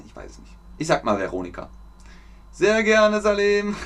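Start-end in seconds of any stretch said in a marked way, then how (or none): 2.5-3.8 high, drawn-out held tone; playful singsong. Real, not acted. Teacher answers Salim's thanks in chat, playful high drawl.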